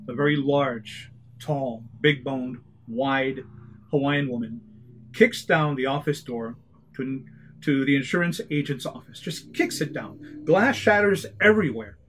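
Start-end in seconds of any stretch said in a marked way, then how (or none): none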